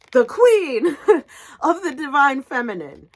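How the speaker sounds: laughing delight. Real, not acted. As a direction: giggle out the grand title. Laughing, high and loud.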